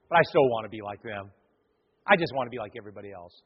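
low, soft admission; sheepish confession. Acted. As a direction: drop down low and admit it quietly. Acting a half-hearted believer confessing.

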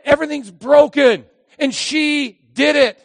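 loud accusing complaint; blaming shout. Acted. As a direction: point the finger and whine. Adam blaming Eve.

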